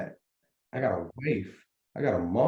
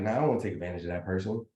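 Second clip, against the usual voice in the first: quick, softer dismissive mutter; mimicking a negative thinker; 5 semitones lower, 5 dB quieter, much faster than usual. Host voicing a negative person's thought, muttered fast and soft. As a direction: toss it off fast like a grumbling naysayer. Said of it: "if you're a negative person".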